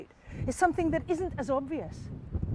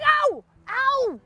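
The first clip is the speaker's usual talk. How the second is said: acted pained howl, very high; high yelp of pain; 11 semitones higher than usual. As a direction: cry out twice as if struck. Wife hit by the rock.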